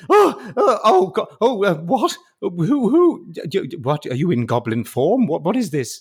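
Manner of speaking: high, stammering confusion; healed scribe gasps at the goblins before him; flustered scribe. Acted. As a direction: splutter in bewildered fright.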